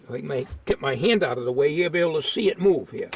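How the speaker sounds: ordinary talk, nothing marked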